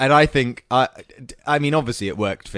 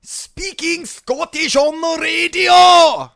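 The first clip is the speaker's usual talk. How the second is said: put-on high, slow, loud Scottish accent shouted; 6 dB louder, much slower than usual. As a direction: bellow it out in a hammed-up Scottish accent. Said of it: "I can do Scottish".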